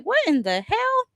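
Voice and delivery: high, shocked "what in the hell"; high-pitched stunned exclamation; shocked outburst. Retold or imitated. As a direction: jump way up in disbelief. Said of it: "I was like".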